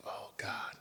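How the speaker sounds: breathy whispered prayer to God; whispered plea. Retold or imitated. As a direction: whisper it like a private wish. Inner plea about injuries.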